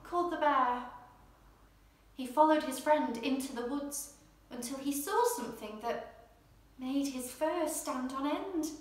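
usual speaking voice

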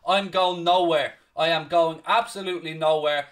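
ordinary talk

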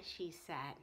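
usual speaking voice

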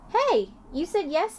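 high, slow delighted cry; amazed the chick answered. A narrator's reading. Junie delighted by peep.

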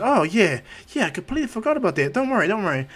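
high-pitched, hurried reassurance; flustered remembering. Acted. Izuku reminded about healing the brother, high flustered reply. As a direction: blurt it out as if just reminded.

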